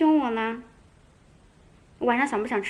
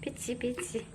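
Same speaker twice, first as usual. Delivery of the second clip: whisper; 11 dB quieter than usual. Real, not acted. Whispering to calm the cat.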